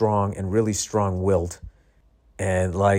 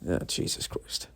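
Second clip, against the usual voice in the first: whispered exasperation; 4 semitones lower, 10 dB quieter than usual. Real, not acted. Exasperated at forgetting the show.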